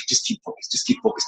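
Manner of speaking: hushed, nearly whispered self-coaching; urgent self-talk. Retold or imitated. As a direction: mutter it to yourself, urging focus. Urging self while learning lines.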